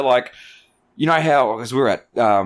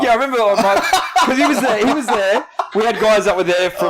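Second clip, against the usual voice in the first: laughing; 10 semitones higher, 4 dB louder than usual. Real, not acted. Laughing while remembering the barber.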